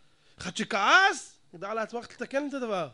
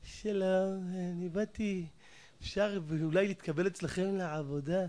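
softer, meek and polite; fawning request; 2 semitones lower, 7 dB quieter than usual. Retold or imitated. Job seeker pleads politely to the board, softer. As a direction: ask humbly for the job. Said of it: "נהיה כזה לפלף".

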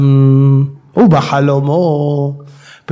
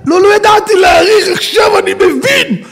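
much higher and loud, repentant; anguished shout; 3 dB louder than usual. Acted. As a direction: cry out your realization. Man repents, learned lesson.

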